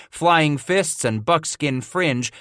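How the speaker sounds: ordinary delivery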